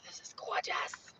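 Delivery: soft breathed-out wonder; looking at the campsite, whispering in awe; awed whisper. Real, not acted.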